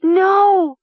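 long, very high, loud cry; drawn-out, high-pitched scream. A narrator's reading. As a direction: scream it out in horror. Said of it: "Mara's first scream".

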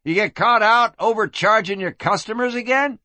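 high, teasing jibe; amused cowboy. Acted. Cowboy mocks the madam from below, amused. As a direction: tease her in a high cowboy voice. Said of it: "laughed a twenty-mile cowboy".

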